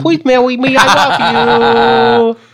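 put-on very slow slurred drunk plea. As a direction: drag it out drunk and pleading. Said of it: "break out the drunk voice".

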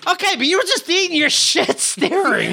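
high, laughing jab; laughing mock scold. Friend imitating Tiffany teasing snacking onlookers, high and laughing. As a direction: tease them through a high laugh. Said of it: "Tiffany's like".

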